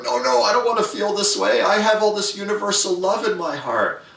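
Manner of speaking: high, loud, pleading self-talk; panicked inner voice. Retold or imitated. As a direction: protest against your own feeling, high and strained. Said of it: "you're looking at yourself going".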